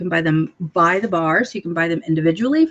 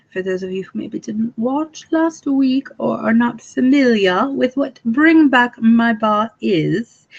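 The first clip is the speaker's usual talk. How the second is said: put-on British accent drifting into a crazy accent; hammed-up British accent going off the rails; mock British accent; 5 semitones higher than usual. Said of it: "my British accent and then ended with a crazy accent".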